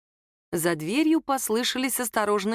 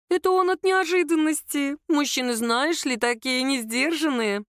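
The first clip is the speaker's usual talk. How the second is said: high-pitched, lightly voiced older woman; placating mother-in-law; 6 semitones higher than usual. A narrator's reading. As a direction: make excuses for a son. Mother-in-law excusing her son.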